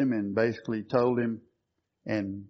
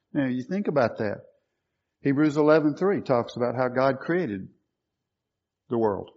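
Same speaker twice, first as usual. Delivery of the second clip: ordinary talk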